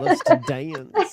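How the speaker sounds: laughing Southern drawl. Pretend. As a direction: drawl "Loves to dance" slowly through a laugh. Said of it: "That was cute".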